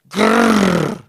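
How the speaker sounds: a growling creak like rusty door hinges; rasping door-creak growl; creaky growl. A narrator's reading. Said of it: "připomínal vrzání dveří na rezavých pantech".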